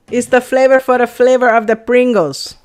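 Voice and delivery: hammed-up Puerto Rican accent; Boricua accent. Joking with viewers about flavor, put-on Boricua accent. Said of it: "Boricua flavor".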